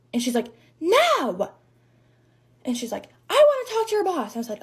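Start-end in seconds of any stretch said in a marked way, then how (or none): 0.8-1.5 high, drawn-out mock refusal; snotty retold no, pitched way up; indignant refusal. Acted angry customer refusing. 3.3-4.4 high-pitched mock customer demand; retold outrage, pitched way up; irate demand. Acted angry customer demanding the boss.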